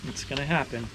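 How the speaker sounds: ordinary delivery, nothing marked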